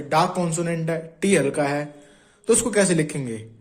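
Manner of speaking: ordinary delivery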